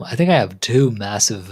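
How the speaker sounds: ordinary talk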